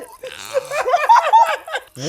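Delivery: shrieking laugh; squealing with laughter; high burst of laughter. Real, not acted. Reacting to gross food.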